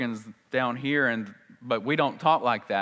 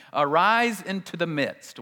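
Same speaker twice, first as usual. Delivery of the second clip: slow, weighty, each word drawn out; slow emphatic reading; 5 semitones higher, 5 dB louder, slower than usual. Shown as a demonstration. Preacher reading the literal Greek to the congregation, slow and emphatic. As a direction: lean on arise, slowly. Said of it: "If you were to translate it literally".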